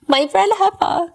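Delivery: high, drawn-out sarcastic imitation; mocking Ashley. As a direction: mimic her sneeringly. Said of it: "she says".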